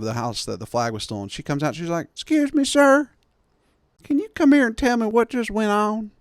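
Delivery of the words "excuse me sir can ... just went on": put-on high, sweet old lady voice; old lady calling to a stranger, high and sweet; old Nashville lady. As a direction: ask politely like an elderly Southern lady.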